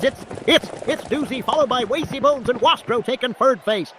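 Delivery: slurring words without dentures, calling a horse race; toothless, slurred track announcer. Acted. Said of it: "slurring his words on the P A system".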